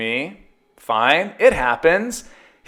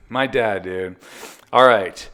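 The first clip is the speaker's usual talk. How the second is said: ordinary talk, nothing marked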